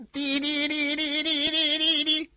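slow mechanical beeping; imitated signal beeps. Warship signalling the ship ahead, slow beeps. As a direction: tap out a radio signal.

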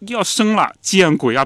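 音调拔高，半喊着模仿; 轻度模仿产妇疼得大叫; 模仿喊叫. Retold or imitated. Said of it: "不停地在那儿惨叫". (姐姐临产疼痛，轻度模仿的喊叫)

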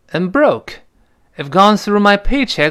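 clear, careful model sentence; model reading. Shown as a demonstration. Teacher reads the full sentence aloud for students. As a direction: read it out for learners to copy. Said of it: "我们完整的读一下这句话".

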